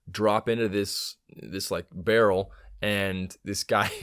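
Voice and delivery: usual speaking voice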